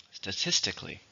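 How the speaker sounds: "Statistically" softly, stressed on TIS; stress on second syllable. Shown as a demonstration. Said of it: "stresses on the second".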